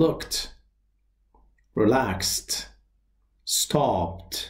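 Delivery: "looked", "relaxed", "stopped" each ending in a crisp t. Shown as a demonstration. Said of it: "ای دی صدای".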